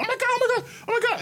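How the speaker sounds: rapturous mock-mom squeal, very high; excited shriek. Imitating mom ignoring dad's question, excited shriek. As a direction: squeal it over and over, imitating her. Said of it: "so she's all excited".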